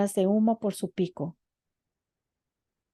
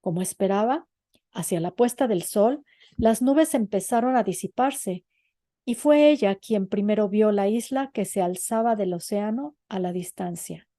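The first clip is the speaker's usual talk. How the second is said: usual speaking voice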